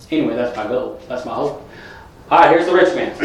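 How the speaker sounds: normal talking voice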